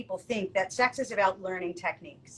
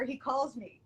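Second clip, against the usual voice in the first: usual speaking voice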